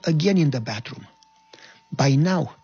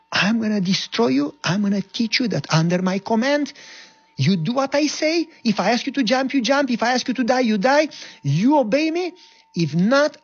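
screaming captain; 9 semitones higher than usual. Retold or imitated. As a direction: threaten him like a brutal officer. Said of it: "And he started to scream".

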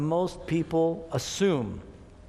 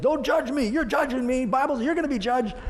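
defensive retort; 7 semitones higher than usual. Retold or imitated. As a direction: push the brother away, offended. Sinner rebuffs rebuking brother.

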